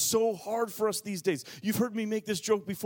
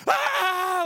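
high, loud cry of joy; excited shriek; 14 semitones higher, 6 dB louder than usual. Retold or imitated. Parents celebrating the toddler's potty success, shrieking with joy. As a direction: shriek with sudden delight. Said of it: "jumping up and down, freaking out, so excited".

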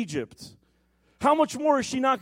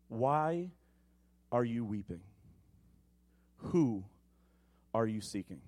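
gentle angel voice; 9 semitones lower, 6 dB quieter than usual. Acted. Angels gently asking the weeping Mary, soft and low. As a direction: ask it softly, like an angel leaning in.

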